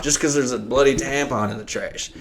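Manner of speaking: ordinary talk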